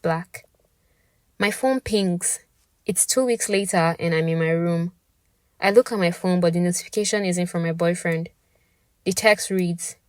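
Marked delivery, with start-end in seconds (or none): none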